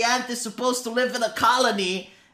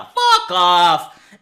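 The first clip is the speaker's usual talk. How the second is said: sudden loud outburst; explosive, fed-up yell; exasperated shout; 3 semitones lower, 6 dB louder than usual. Real, not acted. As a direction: cut them off with a yelled dismissal. Cuts off imagined critic angrily.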